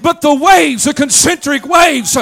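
whooping preacher's crescendo; preaching at full force. Acted. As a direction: shout the victory to the congregation.

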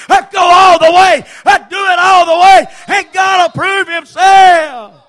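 high, loud whooping preacher cadence; fiery revival preaching; preaching at full force. Acted. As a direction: shout it out, driving each line home. Preaching climbs to shouted close.

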